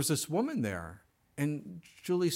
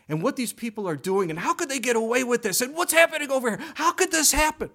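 faster and faster, rising to a loud high cry; building outrage; 7 semitones higher, 8 dB louder, faster than usual. Shown as a demonstration. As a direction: work yourself up into indignation. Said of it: "Oh, I'm getting angry".

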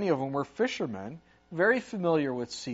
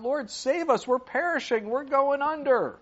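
higher and louder, a desperate retold cry for rescue; crying out in fear; 10 semitones higher, 5 dB louder than usual. Preacher voicing disciples crying out in the storm, higher and louder. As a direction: call out to the Lord like a man going under. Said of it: "And they're crying out".

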